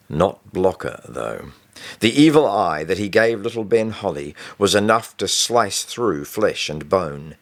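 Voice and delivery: usual speaking voice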